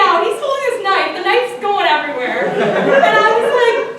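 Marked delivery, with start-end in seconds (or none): none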